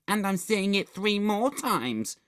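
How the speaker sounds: put-on high squealing girl voice; gleeful squeal. A narrator's reading. Girl boasting about the horror film, squealing. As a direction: squeal it with excitement. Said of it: "she squealed".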